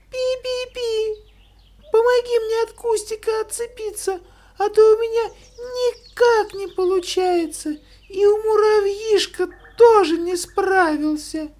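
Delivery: very high squeaky mouse voice. Acted. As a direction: squeak out a plea for help. Mouse pleads to sparrow. (писклявый мышиный голосок)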